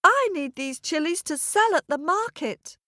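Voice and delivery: put-on high little boy's plaintive plea; almost in tears. Little boy tells ram he needs the chilies, almost in tears. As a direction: explain pleadingly in a small high voice. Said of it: "almost in tears".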